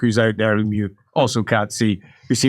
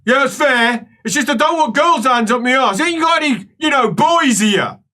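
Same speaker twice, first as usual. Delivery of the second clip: high, whingeing patient voice; concede, then complain; protesting patient; 11 semitones higher, 4 dB louder than usual. Acted. Patient asking for male helpers instead, acted pleading protest.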